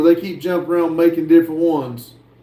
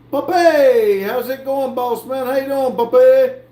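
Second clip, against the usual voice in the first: excited greeting; 8 semitones higher than usual. Real, not acted. Greeting a new viewer.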